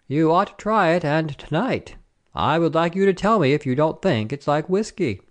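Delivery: a hint of an eager soldier; urging soldier. A narrator's reading. Soldier urging his friends on.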